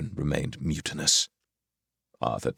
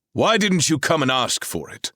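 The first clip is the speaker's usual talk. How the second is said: higher, louder stern challenge; stern king; 11 semitones higher, 4 dB louder than usual. A narrator's reading. Arthur demands what Rahal wants, stern and raised. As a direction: confront her like an angry king.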